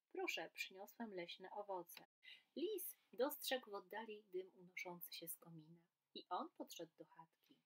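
lively storybook reading with voiced animals; reading to children. A narrator's reading. Teacher reading a story aloud to children, lively and animated. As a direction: read it like a bedtime tale for kids.

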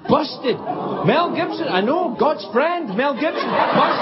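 high, laughing gossip glee; gleeful. Real, not acted. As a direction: crow it like juicy gossip. Gleeful gossip about Mel.

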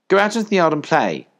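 high, loud dismissal; motherly order. A narrator's reading. Collie sending puppies out, loud and high.

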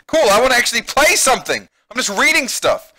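high, loud exasperation; impatient complaint. Real, not acted. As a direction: protest loudly at all the waiting. Complains about too much reading.

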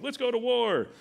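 pumped up for battle, mock chant; hammed-up eager soldiers; eager crowd. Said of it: "they're like".

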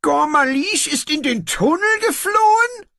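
high, frightened wail. Acted. Antirok hears his accomplice fled, frightened wail. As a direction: wail in panic. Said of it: "heulte er".

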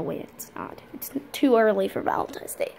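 ordinary talk

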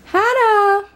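high shout across the room; calling out. Real, not acted. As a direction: call her name from a distance. Calling a friend across the party.

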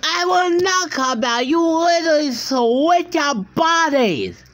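very high, worked-up yelling; acted outraged tirade; furious refusal. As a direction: lose it completely, high and strained. Refuses to calm down, quarrelling.

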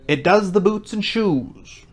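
slow, high, drawn-out pronouncement; solemn. A narrator's reading. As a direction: declare it solemnly, stretching the words. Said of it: "very solemnly".

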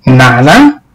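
incredulous. Acted. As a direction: echo "Nada" back in disbelief. Aunt echoes in disbelief.

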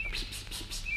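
tiny unvoiced luring psst; soft psst. Pumuckl beckons the mouse at its hole, whispered psst. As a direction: hiss very quietly to lure a mouse.

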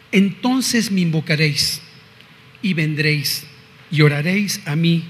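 measured, ringing scripture recitation; scripture reading. Acted. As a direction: proclaim the verses like a preacher. Reads Jeremiah aloud.

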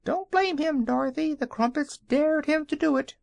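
high hen voice defending the dog; hen calling out. A narrator's reading. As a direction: squawk it up high like a small yellow hen. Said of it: "called out".

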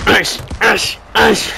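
sharp, loud "ash" bursts with each punch; boxer's punch hisses. Real, not acted. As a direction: spit out a noise on every jab. Vocal bursts while throwing punches.

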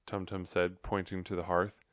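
ordinary delivery, nothing marked

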